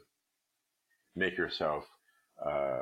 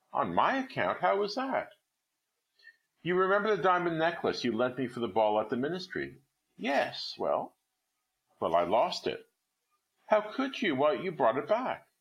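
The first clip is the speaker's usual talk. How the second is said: much higher incredulous questions; disbelieving, rising voice; astonished; 9 semitones higher than usual. Acted. Friend shocked by confession.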